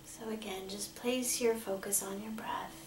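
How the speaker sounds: soft, measured meditation voice with long pauses; guided meditation. Acted. Meditation leader asking angels and guides for protection, calm and hushed. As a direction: gently lead listeners into calm, leaving space to breathe.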